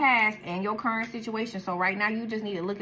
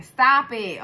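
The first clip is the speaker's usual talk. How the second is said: loud scolding; 3 semitones higher, 9 dB louder than usual. Real, not acted. Scolding the viewer.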